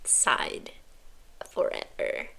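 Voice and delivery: fading into a whisper; mock farewell whispered away. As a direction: let it drift off unvoiced. Mock goodbye trailing off.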